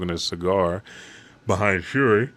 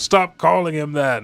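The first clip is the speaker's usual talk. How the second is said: much higher, louder furious order; angry shout; 9 semitones higher, 7 dB louder than usual. Retold or imitated. Brook orders Shuri to stop insulting the king, angry shout. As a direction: cut her off hard.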